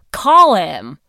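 firm, forceful command. A narrator's reading. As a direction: insist loudly, brooking no argument. Heidi repeats her order.

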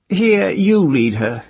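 high, slow command; captor's order. Acted. Captor tells another man to lead her, slow and high. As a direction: hand off the leash.

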